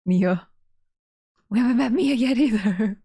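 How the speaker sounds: ordinary delivery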